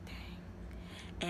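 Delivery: breathy whispered dismay, barely voiced; imitated whisper. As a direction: whisper it like she's stunned. Wife finds lover's body.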